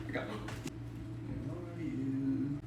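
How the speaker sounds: very soft, very slow, with a gasp; soft searching. Real, not acted. Vlogger looking under the couch for the opossum, hushed and slow. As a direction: hold your breath and search.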